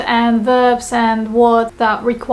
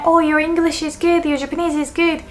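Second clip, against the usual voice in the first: higher-pitched, mildly gushing praise; acted compliment; 5 semitones higher, faster than usual. Complimenting someone on their language skills, acted compliment. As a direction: pay a compliment in a raised voice.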